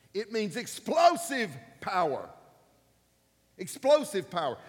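preaching. Acted. Pastor preaching to the congregation, booming. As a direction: drive home EXPLOSIVE power.

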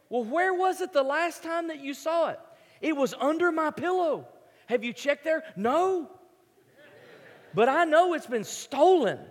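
hammed-up kid insisting it was stolen; insistent kid. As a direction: squeak out your certainty like a seven-year-old. Camper insists money stolen.

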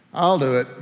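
grudging, subdued mumble; reluctant mock volunteer. Shown as a demonstration. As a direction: agree with no eagerness at all. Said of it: "did he say it like this".

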